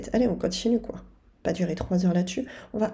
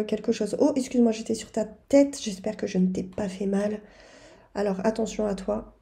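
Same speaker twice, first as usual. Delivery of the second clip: ordinary delivery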